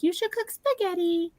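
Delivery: put-on squeaky high baby-bird voice; cute parrot baby voice. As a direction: chirp it like a tiny talking parrot. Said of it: "this cute little baby voice".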